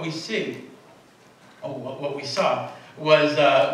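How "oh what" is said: ordinary talk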